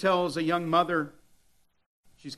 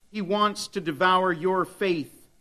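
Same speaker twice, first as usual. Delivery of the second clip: normal talking voice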